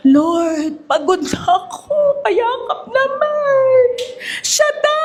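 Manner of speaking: high, crying plea for a hug; a weary believer begging the Lord for comfort; exhausted plea. Pretend. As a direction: weep to God that you are worn out. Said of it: "Shaddai provides strength when we have none left".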